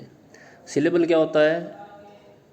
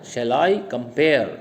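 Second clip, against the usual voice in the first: Shall I compare, slowly, leaning on I and pare; slow weak-strong beats in Shall I compare; iambic stress demo; 2 semitones lower, much slower than usual. Shown as a demonstration. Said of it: "pair पे ज़्यादा देना है". (Shall I compare धीरे, I और pare पर ज़ोर)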